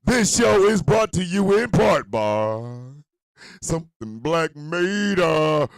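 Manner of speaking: slow, loud announcer stretch; booming slow sponsor read; drawn-out announcer. Acted. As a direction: stretch every word like a hype man.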